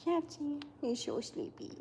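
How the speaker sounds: very soft, hushed cooing; cooing to a pet. Real, not acted. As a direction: murmur over a sleepy pet.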